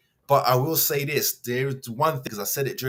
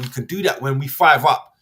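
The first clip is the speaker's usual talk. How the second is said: usual speaking voice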